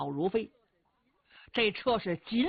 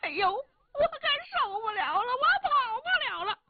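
very high, thin, pained whine; whining complaint.

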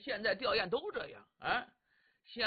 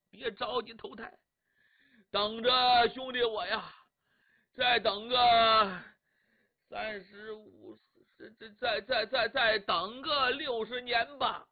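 crying lament; 4 dB louder than usual. Acted. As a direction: sob and drag out the cries over the body. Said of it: "哭一阵儿，嘟囔一阵儿".

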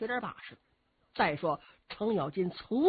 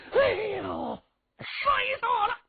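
pained wail; 3 dB louder than usual. Acted. Cheng Yaojin falls flat.

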